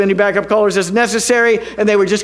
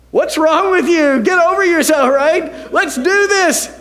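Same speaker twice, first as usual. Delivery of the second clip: put-on higher, brisk rebel teen nudge; pastor egging him on; 9 semitones higher than usual. Pastor Melody urging the nervous son, higher teasing push. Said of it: "she was like".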